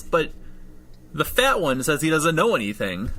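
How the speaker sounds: high-pitched earnest rookie voice; naive rookie cop. Acted. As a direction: report to a superior in a high, eager voice. GM voicing Joey reporting to Solar.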